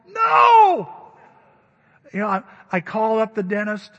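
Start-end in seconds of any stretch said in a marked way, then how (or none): 0.1-1.1 high, loud, sharp refusal; shouted no. Retold or imitated. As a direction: yell it through the pain. Rejecting the poor-thing comfort.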